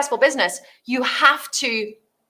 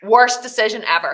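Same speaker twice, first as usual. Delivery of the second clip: usual speaking voice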